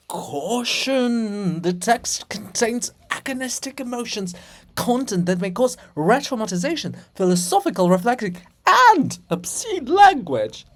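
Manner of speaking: high, loud warning-label voice; mock alarm. Reader warns the audience about the text, hammed-up alarm. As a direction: sound the alarm like a hammed-up announcer.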